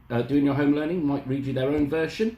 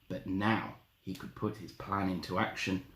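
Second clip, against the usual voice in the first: usual speaking voice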